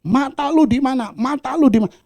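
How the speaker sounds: loud, high mock-angry demand; angry man. Angry man demanding where his eyes are, mock-angry loud. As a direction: bark it like a hothead. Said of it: "Orang marah itu".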